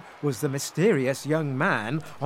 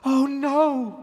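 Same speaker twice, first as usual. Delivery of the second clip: high, louder dismayed cry; cried out; 12 semitones higher, 5 dB louder than usual. A narrator's reading. Rose cries out, realising she revealed the secret. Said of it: "cried Rose".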